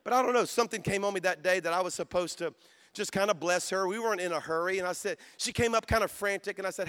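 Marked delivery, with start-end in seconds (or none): none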